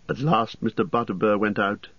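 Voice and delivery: usual speaking voice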